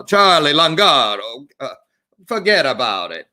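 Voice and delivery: put-on light Italian American accent, just for fun; mock Italian accent. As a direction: play a New York Italian tough guy, tongue in cheek. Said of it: "a pretty good impersonation".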